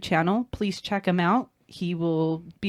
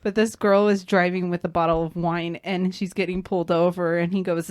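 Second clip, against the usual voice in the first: ordinary talk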